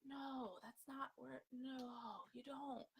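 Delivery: half-hearted Smeagol protest; lightly voiced Smeagol. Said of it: "Smeagol brain's like".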